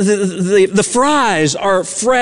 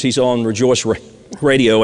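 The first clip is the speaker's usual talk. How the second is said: ordinary talk, nothing marked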